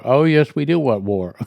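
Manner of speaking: loud, put-on sneering voice of the two leaders; mocking Russia and China, loud gloating voice. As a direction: ham up a gloating villain admitting it. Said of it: "they're saying".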